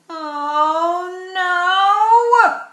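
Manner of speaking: loud, high cry of dismay; exasperated Mister Bear. Acted. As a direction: groan it out like a fed-up bear. Fed-up bear exclaims in story.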